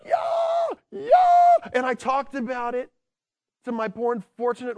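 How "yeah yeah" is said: high, loud triumphant yells; cheering yell. Retold or imitated. Fan at the game cheering the winning score, full shout. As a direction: yell like your team just won.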